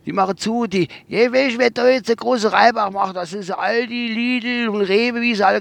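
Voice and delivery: driver's voice. Retold or imitated. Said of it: "hat er gesagt".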